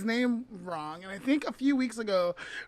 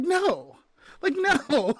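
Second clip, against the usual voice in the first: mock refusal; 7 semitones higher, 6 dB louder, much slower than usual. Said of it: "I'm like".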